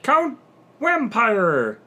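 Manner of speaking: high, loud announcer reveal; dramatic reveal. Acted.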